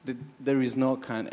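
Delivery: ordinary delivery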